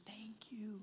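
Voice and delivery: hushed, voiceless gratitude; whispered thanks. Retold or imitated. Dad thanks boys for daughter's trophy, whispered. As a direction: whisper it as if moved.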